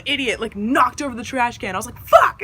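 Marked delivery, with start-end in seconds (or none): 2.0-2.4 loud, high burst of alarm; sudden high-pitched shout; exclaimed curse. Retold or imitated. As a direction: yelp it as the disaster happens. Trash can knocked over, retold.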